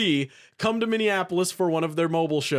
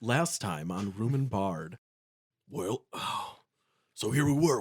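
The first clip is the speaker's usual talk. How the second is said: recap voice; 6 semitones lower, 6 dB quieter than usual. Acted. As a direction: set up the last episode. Recap in announcer voice.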